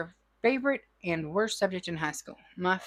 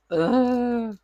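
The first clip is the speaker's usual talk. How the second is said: disgusted ugh; 3 semitones higher, 6 dB louder than usual. Retold or imitated. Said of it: "So I was like".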